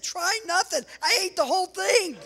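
high, loud comic protest; emphatic boast. Retold or imitated. Dad answering daughter's text about the meat, boastful. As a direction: crow it loudly over the listener's question.

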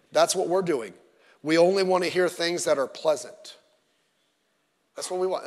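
ordinary talk

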